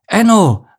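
loud, high call of a name; father calling his son in danger; loud call across the water. Acted. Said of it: "rief er".

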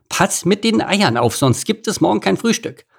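high, brisk commanding meerkat; bossy meerkat chief. A narrator's reading. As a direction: order the workers about. Boss warning worker.

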